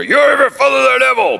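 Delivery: preacher shows how the line is read in anger, a furious shout; angry. Shown as a demonstration. Hurl it at them in fury. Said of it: "in anger".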